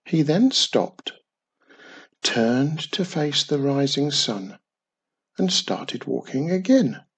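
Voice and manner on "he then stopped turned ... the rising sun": usual speaking voice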